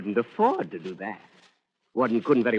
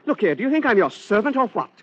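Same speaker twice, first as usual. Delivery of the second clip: higher, louder indignant protest; 10 semitones higher, 5 dB louder, faster than usual. Acted. Gentleman protesting he is no servant, indignant. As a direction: bristle at being called a servant.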